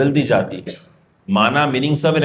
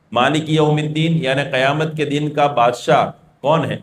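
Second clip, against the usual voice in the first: normal talking voice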